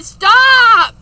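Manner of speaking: sudden high, loud shout; real shout. Real, not acted. Host yells at her flickering lights in real alarm.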